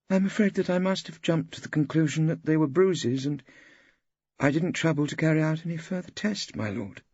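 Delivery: lady doctor confesses to the judge, sheepish; doctor witness. A narrator's reading. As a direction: admit the mistake to the judge, embarrassed. Doctor witness admits fault.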